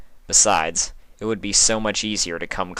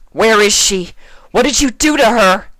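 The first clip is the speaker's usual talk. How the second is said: much higher, loud furious shout; furious demand; 10 dB louder than usual. A narrator's reading. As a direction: shout down the monster. Twilight confronts monster.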